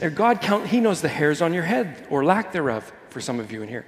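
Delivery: ordinary talk, nothing marked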